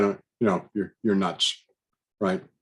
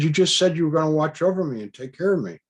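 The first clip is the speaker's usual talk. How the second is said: higher, hurried objection; protesting; 7 semitones higher, 4 dB louder, faster than usual. Retold or imitated. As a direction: rush out a puzzled protest, pitch up. Retold protest at a contradiction.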